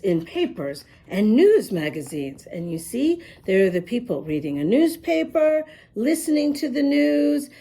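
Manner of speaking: plain reading voice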